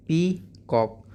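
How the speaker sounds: Peacock broken into two drawn-out beats, pee... cock; split into syllables. Shown as a demonstration. Teacher breaking the word for pupils, syllable by syllable. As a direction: say peacock slowly, a pause between pee and cock. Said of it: "peacock को हमने दो part में तोड़ दिया".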